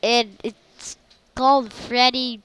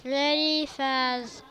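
ordinary talk

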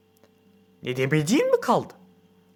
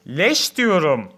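slow, louder, each word pushed; getting louder word by word; rising voice; 2 semitones higher, 8 dB louder, much slower than usual. A narrator's reading. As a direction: start raising the voice. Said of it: "Giderek sesini yükseltiyor".

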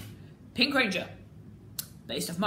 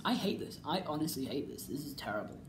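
softer and lower, trailing into a mutter; fading disgusted mutter; 8 semitones lower, 10 dB quieter than usual. Real, not acted. Fan quietly giving up on the design. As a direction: let the disgust sink away.

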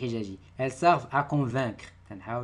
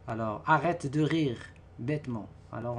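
ordinary delivery

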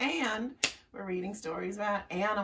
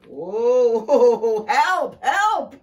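very loud, high, drawn-out cry; bellowing; 8 semitones higher, 16 dB louder, much slower than usual. Acted. Bear fleeing the burrow, huge bellowing cry. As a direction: bellow for help in comic panic. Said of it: "bellowed Bear".

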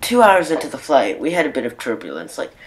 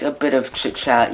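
ordinary delivery

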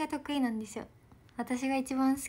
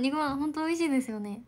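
normal talking voice

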